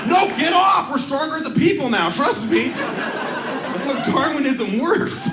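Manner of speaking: high, loud ranting; shouting at would-be helpers about Darwinism; mock rant. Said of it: "And was like".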